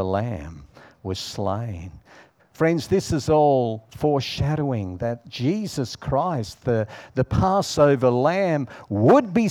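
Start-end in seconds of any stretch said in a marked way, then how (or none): none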